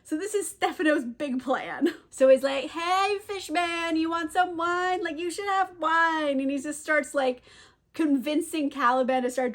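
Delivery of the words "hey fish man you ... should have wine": higher, drawn-out mock coaxing. Drunk cook tempts fish man with wine, mock coaxing. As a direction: sing out the offer, luring playfully. Stefano luring Caliban with wine.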